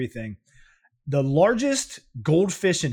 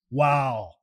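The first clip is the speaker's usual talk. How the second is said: ordinary talk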